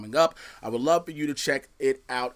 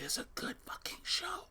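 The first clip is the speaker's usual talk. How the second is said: whispering; 6 semitones higher, 10 dB quieter than usual. Real, not acted. Secret tip whispered to listeners.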